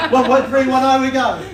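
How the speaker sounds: loud, laughing through the call. Real, not acted. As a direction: laugh it out loudly. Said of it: "Corrected myself".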